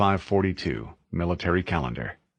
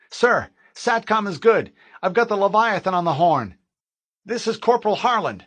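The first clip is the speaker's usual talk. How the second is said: higher, louder urgent soldier voice; tense young Marine calling it in; young corporal on radio; 12 semitones higher, 5 dB louder than usual. Acted. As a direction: report in over the headset, keyed up.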